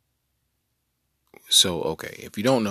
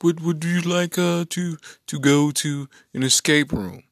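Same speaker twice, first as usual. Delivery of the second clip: imitating brother; 9 semitones higher, 5 dB louder than usual. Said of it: "brother number two calls me and say".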